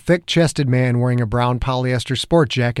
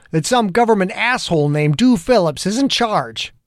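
building heated cop rant, pitched up; angry rant; 6 semitones higher than usual. A narrator's reading. Angry detective venting at the federal agent. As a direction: vent the whole bad day at him.

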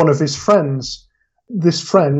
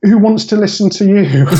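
put-on broad Lancastrian accent; 5 semitones higher, 4 dB louder than usual. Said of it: "in a broad Lancastrian accent".

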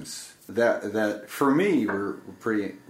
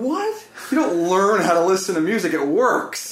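much higher, disbelieving outburst; incredulous; 9 semitones higher, 4 dB louder than usual. Retold or imitated. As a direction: scoff at something absurd. Incredulous retort to the woman.

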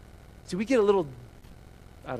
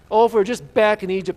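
loud, higher mock whine; whiny moaning; 5 semitones higher, 9 dB louder than usual. Israelites moaning to their leaders, whiny. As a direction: moan it like a hammed-up whiner. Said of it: "I gotta say this whiny voice".

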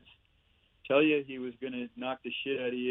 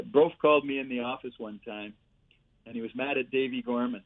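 ordinary talk

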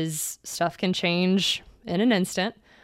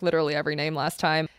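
usual speaking voice

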